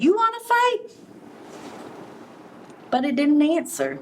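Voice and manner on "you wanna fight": grouchy ladybug. Acted. Ladybug calling out to the elephant, high and cocky. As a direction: shout up at the big beast. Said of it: "and she said".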